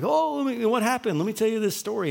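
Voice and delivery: high, loud mock of an over-eager guy; eager stranger. Pastor mimics a stranger eager to share his surgery story, high and loud.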